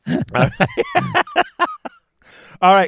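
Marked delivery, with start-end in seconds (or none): 0.6-1.9 squealing laugh; high laugh. Real, not acted. Keeps laughing at Chris.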